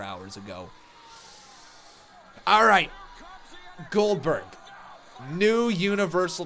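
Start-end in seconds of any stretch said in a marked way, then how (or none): 2.4-6.5 loud, higher outburst; sudden shout. Real, not acted. Streamer bursting out to his viewers, shouting. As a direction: blurt it out at full volume. Bursting out about the big news.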